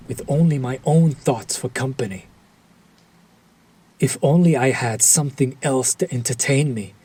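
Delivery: normal talking voice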